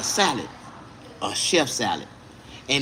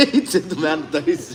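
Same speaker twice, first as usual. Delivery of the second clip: amused, laughing words. Real, not acted. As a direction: repeat it through real laughter. Repeats it amid laughter.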